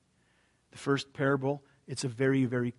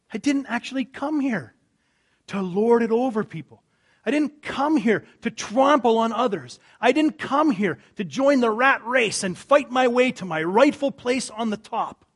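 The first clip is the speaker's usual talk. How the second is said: loud, high, impassioned declaration; fervent voice of Jesus; preaching at full force; 10 semitones higher, 11 dB louder than usual. Acted. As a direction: proclaim it to the whole crowd.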